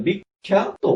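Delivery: বিখ্যাত split as "bik-khya-to"; doubled-consonant pronunciation. Shown as a demonstration. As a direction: stop on the k, then stretch "khya-to" in বিখ্যাত. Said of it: "প্রথমটি হলো কটি অল্প প্রাণ ক".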